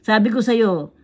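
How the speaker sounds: ordinary talk, nothing marked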